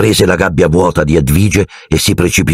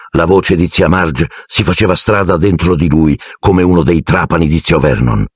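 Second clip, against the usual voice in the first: ordinary delivery, nothing marked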